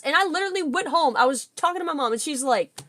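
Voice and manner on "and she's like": normal talking voice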